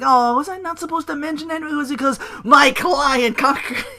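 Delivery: hammed-up sly manager; Paul Heyman impression. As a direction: feign innocence while twisting the knife. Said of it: "he's like".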